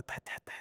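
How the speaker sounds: soft voiceless tapping sounds; imitated tail thumps. Preacher imitating the dog's tail thumping the floor, soft tapping. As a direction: tap out the tail beating under the sofa. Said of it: "escuchaba la cola".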